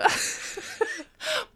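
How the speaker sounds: high breathy giggle. Real, not acted. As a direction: burst into giggles. Laughing at her own video.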